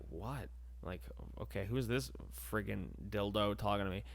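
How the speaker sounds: low, very soft aside; muttered annoyance. Acted. Counter girl wondering who's talking to her, muttering. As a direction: mutter it to yourself, put out.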